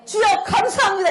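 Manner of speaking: high-pitched retold prayer cry; proclaiming thanks. Thanking the Lord while fighting annoyance, high declaration. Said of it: "이렇게 선포를 했거든요".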